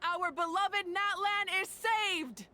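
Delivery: high, slow, drawn-out declaration; triumphant proclamation. Acted. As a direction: proclaim the victory to the crowd. Leader proclaims victory to crowd.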